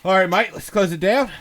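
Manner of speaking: loud high Australian sign-off; mock Australian accent. Host closes the show in a loud mock Australian accent. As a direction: wrap up the show as a hammed-up Aussie. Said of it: "I just went from Australia".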